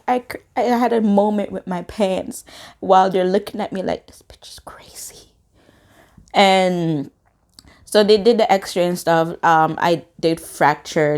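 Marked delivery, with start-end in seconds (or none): 4.1-5.3 unvoiced whispered aside; hushed, judging whisper; whispered judgement. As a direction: whisper it like a stunned onlooker. Onlookers' silent judgement, retold.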